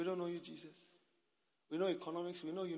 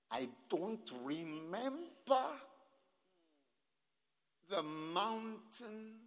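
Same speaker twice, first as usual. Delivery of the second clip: very slow, stretched words, rising louder; drawn-out recollection; 6 semitones higher, 7 dB louder, much slower than usual. Acted. Preacher voicing one who forgot the calling, slow and drawn out. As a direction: stretch every word out long and loud.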